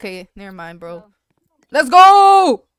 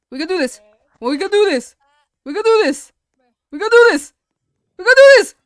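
psyching up, building; 3 dB louder, faster than usual. Real, not acted. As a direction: pump yourself up, louder each time. Psyching up before a jump.

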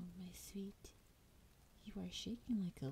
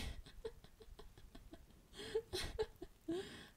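high delighted cackle. Acted. Dragon laughs at threat.

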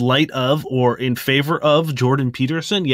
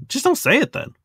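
higher, exasperated plea; 12 semitones higher than usual. Real, not acted. Exasperated advice to profile writers.

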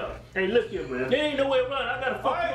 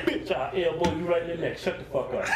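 ordinary talk

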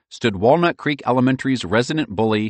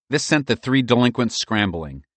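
ordinary delivery, nothing marked

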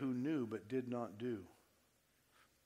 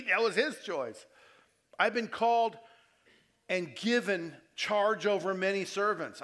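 louder preaching with rising pitch; fervent preaching; 5 semitones higher, 9 dB louder than usual. Acted. Pastor preaching to his congregation with fervent force. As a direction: preach it out, building to full force.

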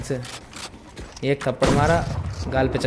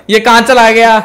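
high, loud laughing what-am-I-seeing; laughing disbelief; 7 semitones higher, 8 dB louder than usual. Real, not acted. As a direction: crack up at the replay. (हँसते हुए ऊँची आवाज़ में हैरानी)

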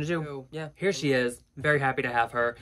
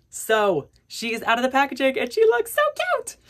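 excited; 12 semitones higher, 7 dB louder than usual. Real, not acted. Doll out of box, gushing.

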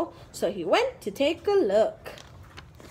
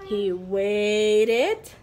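usual speaking voice

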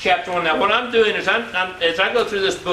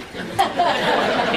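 a short, real burst of laughter at his own joke; 7 semitones higher than usual. Real, not acted. Man laughing at his own mirror joke, real laugh. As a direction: let out a real laugh, higher.

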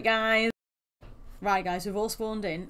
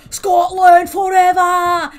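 loud, higher, slow Scottish battle cry; Scottish accent; 9 semitones higher, 14 dB louder, much slower than usual. Pretend. Gamer asked to do Scottish bellows a Scottish battle cry. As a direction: roar it like a Scottish war cry. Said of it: "Can you do Scottish?".

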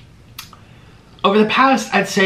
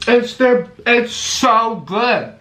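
excited; 6 semitones higher, much slower than usual. Real, not acted. Raving about food.

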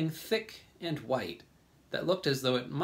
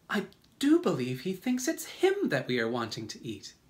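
raised, put-on ladybug voice; ladybug character; 5 semitones higher, 3 dB louder than usual. A narrator's reading. Ladybug realises James's fear, high exclamation. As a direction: voice it higher, as the ladybug. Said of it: "the ladybug cried".

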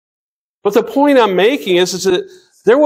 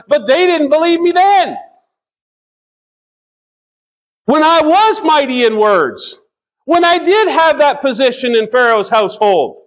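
high-pitched, emphatic protest as Moses; acted objection, leaning on the key words; 8 semitones higher than usual. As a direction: plead the case, pitched well up. Preacher voicing Moses' protest.

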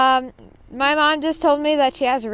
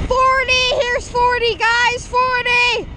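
excited shouting; 11 semitones higher, 5 dB louder, slower than usual. Real, not acted. Shouting speed over wind noise.

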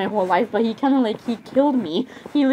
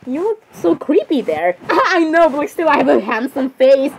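ordinary delivery

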